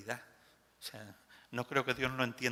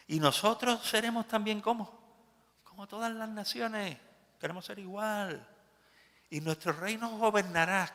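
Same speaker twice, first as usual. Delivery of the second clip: defiant people; 8 semitones higher, 4 dB louder than usual. Acted. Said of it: "Y dijo".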